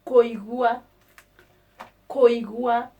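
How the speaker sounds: Koigua said loud, koy-goo-ah; Kikuyu word. Shown as a demonstration. Teacher drills Koigua for the class, loud. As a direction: call out Koigua clearly. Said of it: "concentrate on to hear".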